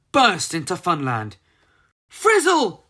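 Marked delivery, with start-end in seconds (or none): 2.1-2.9 loud high call of a name. A narrator's reading. Molly shouting for Frizzle through the crowd, loud and high. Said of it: "shouted Molly".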